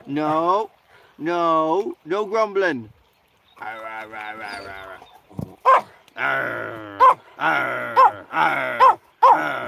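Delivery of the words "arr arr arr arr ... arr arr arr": low imitated growling grumbles; mock dog grumble. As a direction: grumble back at the dog like he does. Mimicking dog's grumbles.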